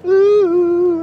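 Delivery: long, loud, high "ooh"; high hoot. Real, not acted. Host alone on his night drive, loud high hoot.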